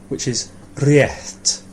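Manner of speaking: réirt spoken slowly, with a breathed-out t at the end; slow réirt demo. Shown as a demonstration. Teacher slowly models réirt for the student. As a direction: spell out réirt slowly for the learner. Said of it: "That's it, with this".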